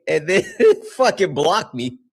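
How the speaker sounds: ordinary talk, nothing marked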